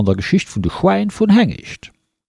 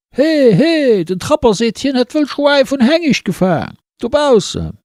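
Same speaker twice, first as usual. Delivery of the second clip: much higher, excited street calling; villagers calling out; 12 semitones higher than usual. Acted. Villagers shouting the news through the streets, high excited calls. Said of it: "hun se gerufen".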